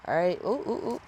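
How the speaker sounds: normal talking voice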